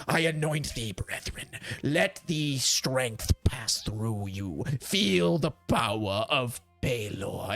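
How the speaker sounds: slow, low solemn blessing; drawn-out, reverent priestly voice; anointing priest. Acted. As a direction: intone it like a holy rite. Priest anoints the kneeling soldiers.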